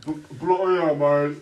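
loud, high-pitched British cursing; mock British accent. Prankster mocking the British players, shouted mock British. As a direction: yell it like a cartoon Brit. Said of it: "this is the British".

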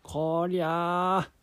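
put-on high, loud old man. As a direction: boast like an excited old grandpa. Grandpa voiced again.

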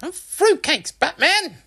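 loud, very high mother's outburst; exasperated exclamation. A narrator's reading. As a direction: cry out a mild swear as Mum. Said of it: "Mum exclaimed".

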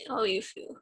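breathy whispered question. Real, not acted. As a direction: drop to a whisper. Asks in a sudden whisper.

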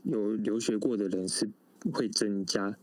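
ordinary talk, nothing marked

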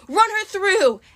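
pirate battle order. A narrator's reading. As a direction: call the crew to attack. Pirate captain ordering crew to attack.

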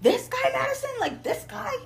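high, incredulous mock yell; yelling sister. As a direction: imitate an outraged older sister. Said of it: "My older sister".

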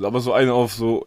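ordinary talk

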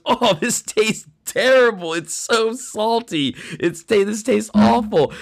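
mock disgust. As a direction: imitate recoiling from a foul taste. Student spits out salt water.

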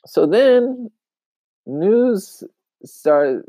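ordinary talk, nothing marked

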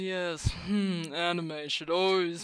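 slow, snooty anime purist; pompous voice. Acted. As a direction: look down your nose, drawn out. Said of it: "an excuse to have that pompous voice".

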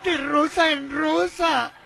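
grand, slow poem recital; poetry declamation. Acted. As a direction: declaim it like a mock romantic poet. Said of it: "Mira, ahí te va".

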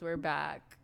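ordinary talk, nothing marked

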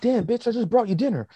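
a hammed-up goon howling at the woman who hurt him; mock pained goon.

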